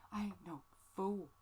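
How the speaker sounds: sassy, streetwise rephrase with attitude; sassy slang. Pretend. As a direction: say it again with swagger. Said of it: "I'm not a fool".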